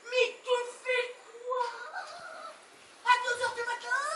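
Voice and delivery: high, outraged disbelief; alarmed protest. Acted. Wife stops husband going to open the door, alarmed. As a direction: pull him up short, pitched high.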